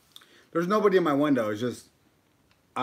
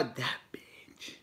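whispered playful jab; whispering; 13 dB quieter than usual. Real, not acted. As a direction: whisper it in mock spite. Playful jab at a fellow streamer.

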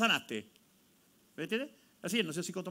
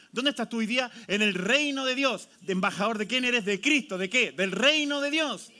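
building fervent preaching; preaching call-and-response; 4 semitones higher, 5 dB louder than usual. Real, not acted. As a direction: rouse the congregation.

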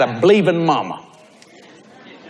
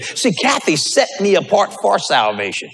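ordinary delivery

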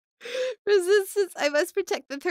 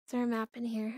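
ordinary talk

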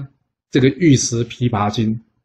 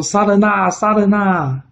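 high panicked cry of "murder"; high, alarmed shout of a bystander; crowd shouting murder; 9 semitones higher, slower than usual. Acted. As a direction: yell the alarm like a shocked onlooker. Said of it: "就大家喊说".

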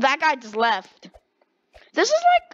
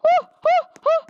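excited whooping; 7 dB louder than usual. Real, not acted. Celebrating kill in game.

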